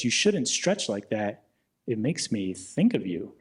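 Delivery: put-on old gym creep leering at a young woman, low and slow; creepy old man. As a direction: croon it like a lecherous old man.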